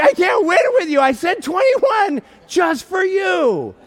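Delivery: high, loud comic outburst; exasperated yelling. Retold or imitated. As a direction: wail it loudly, stretching the ending. Retold exasperation, cannot win.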